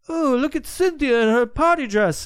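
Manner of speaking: mock the creepy handler sweetly. Podcaster mocking a creepy handler's caption, high sing-song.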